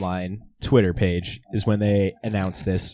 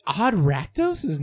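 high, disbelieving question, louder at the end; incredulous; 5 semitones higher, 3 dB louder than usual. Real, not acted. Disbelieves the deck name is clever.

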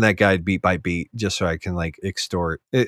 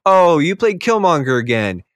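sarcastic mock praise; 6 semitones higher, slower than usual. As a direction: fake enthusiasm at a predictable play. Mock-bored quote to opponents.

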